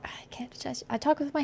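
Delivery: whisper. Real, not acted. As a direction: drop to a whisper for the aside. Whispers an aside.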